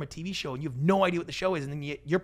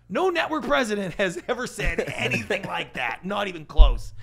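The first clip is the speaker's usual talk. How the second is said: real laughter breaking through the words, louder; amused, laughing delivery; 4 semitones higher, 10 dB louder than usual. Real, not acted. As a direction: laugh out the disbelief. Laughs while telling the story.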